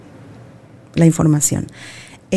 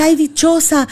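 higher-pitched mock admiring exclamation; imitated envious gushing; 8 semitones higher than usual. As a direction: gush like an envious admirer, lightly mocked. Imita a gente que la envidia. (imitando admiración con envidia)

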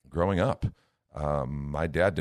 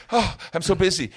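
high, loud put-on boast; hammed-up humblebrag; mock bragging busy; 12 semitones higher, 7 dB louder than usual. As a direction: show off how busy you are. Imitates people bragging busy.

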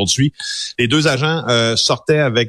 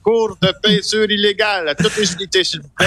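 police radio; 10 semitones higher than usual. Retold or imitated. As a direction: blare it like a dispatch call. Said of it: "qui appelle à la centrale".

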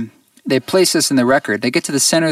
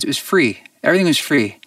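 usual speaking voice

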